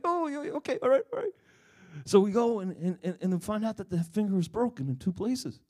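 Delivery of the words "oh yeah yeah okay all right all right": high, loud, hurried giving in; flustered agreement. Retold or imitated. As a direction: tumble out the yeses in a flustered rush.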